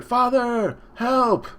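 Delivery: high-pitched mock child cry; imitated desperate call; plea for help. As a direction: act out a boy calling for rescue. Sanji begs father for help.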